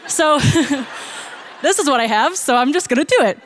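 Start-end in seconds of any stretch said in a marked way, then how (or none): 0.4-1.6 laughing. Real, not acted. Laughing at own story.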